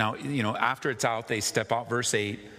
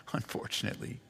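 rueful laugh; 9 dB quieter, faster than usual. Real, not acted.

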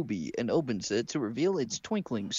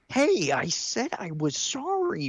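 fed-up retort; 4 semitones higher, much slower than usual. Acted. Ruby fed up.

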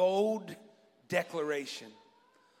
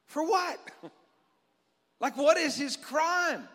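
pitched high in disbelief; incredulous; 5 semitones higher than usual. Real, not acted. Preacher baffled by the crowd's charge, incredulous. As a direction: ask it as if it makes no sense.

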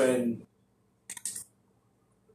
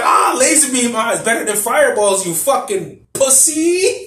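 high, loud jeering, stretched-out ending; imitated triumphant crowing; mock gloating taunt; 9 semitones higher, 5 dB louder, slower than usual. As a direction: shout the taunt, hammed up and drawn out. Acting the gloating insult a second time.

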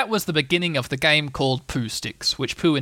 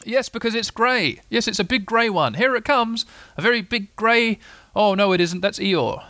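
higher voice, eager announcing; excited Pooh; 8 semitones higher than usual. Acted. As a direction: call out each sighting in lifted tones. Pooh exclaiming in story.

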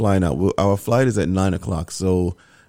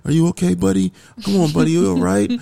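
put-on higher, gentle coaxing to a sick little boy; dad checking on his feverish son, higher and tender; tender to child; 8 semitones higher than usual. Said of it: "I'm just like".

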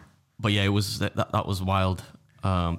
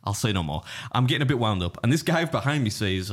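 normal talking voice

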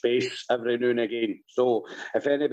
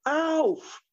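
put-on very high-pitched wee boy calling out; high mock child's holler; imitated toddler shout; 14 semitones higher, slower than usual. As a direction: mimic a three-year-old yelling up the house, pitched right up. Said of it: "he shouted".

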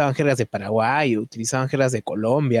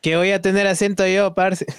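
higher, teasing Colombian voice; mock Colombian accent; 7 semitones higher than usual. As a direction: imitate a Colombian denying his accent. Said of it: "lo chistoso es que todos te dicen".

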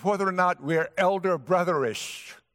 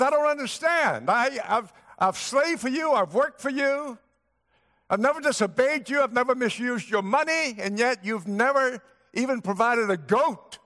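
angry complaint; 8 semitones higher than usual. Acted. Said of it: "this record says he was angry".

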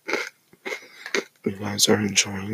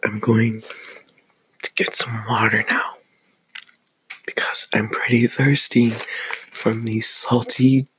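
whispering. Acted. ASMR whispered aside to viewers.

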